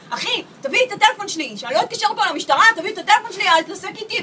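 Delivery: loud, high angry threats at the thief; angry yelling. Retold or imitated. Boyfriend yelling at the thief for his phone, loud and angry. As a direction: yell it at him, demanding the phone back. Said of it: "ואז הוא מתחיל לצעוק עליו".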